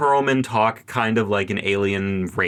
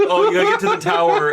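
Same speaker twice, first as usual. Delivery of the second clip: mock line; 4 dB louder, faster than usual. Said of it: "Just have it be like".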